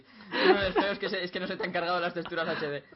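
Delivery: giggling through the line; laughing while quoting a joke. Retold or imitated.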